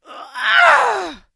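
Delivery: loud, high creature cry; monster hag shriek. Acted. Hag makes her move.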